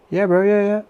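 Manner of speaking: high, loud, keen yeah bro; eager agreement. Retold or imitated. Cool workmate cheerfully agreeing to move the stuff, high and loud. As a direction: bounce it up like a chummy workmate.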